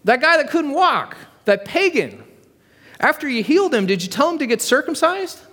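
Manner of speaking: high, pointed voice of a busybody; shrill-pitched know-it-all question; nosy heckler. Acted. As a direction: pipe up high from the back of the room. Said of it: "And the guy's like".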